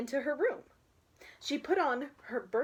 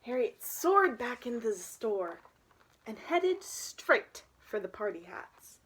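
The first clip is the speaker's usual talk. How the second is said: ordinary delivery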